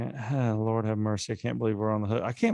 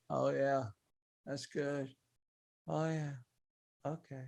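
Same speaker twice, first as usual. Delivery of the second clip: quiet Joe Pesci scene; 3 semitones higher, 10 dB quieter than usual. Acted. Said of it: "Two scenes".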